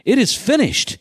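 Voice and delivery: high, emphatic echo of the cry; retold shout. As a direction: stress it as a quoted shout. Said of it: "why had Jesus shouted".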